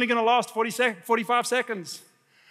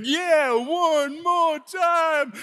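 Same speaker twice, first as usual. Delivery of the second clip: high, very drawn-out whoop; rowdy cheering rider; 6 semitones higher, much slower than usual. Retold or imitated. Guy in the front urging another ride, high drawn-out cheer. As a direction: stretch it out high like an egging-on fan. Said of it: "the guy in the front's going".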